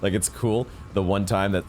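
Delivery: ordinary delivery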